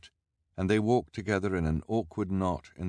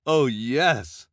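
slow, louder fawning reporter voice; gushing reporter; 8 semitones higher, 8 dB louder than usual. A narrator's reading. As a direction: spell out the headline with relish.

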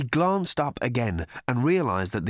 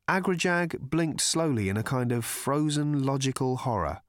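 ordinary delivery, nothing marked

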